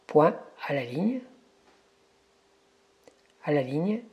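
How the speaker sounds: dictating. Shown as a demonstration. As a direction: spell out each phrase for the mic. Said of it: "Nous allons faire une démonstration de saisie de texte en dictée vocale".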